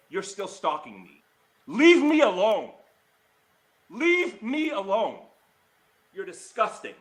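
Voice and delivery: real outrage, pitch soaring on the accusations; losing your cool, stretching out the demands; mad, heated. Real, not acted. Vlogger confronting former friend over mocking and stalking, heated and mad. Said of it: "I'm sorry, but I'm mad".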